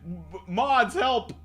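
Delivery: loud, high-pitched pleading; mock cry for help. Streamer calling mods about a spam link, mock alarm.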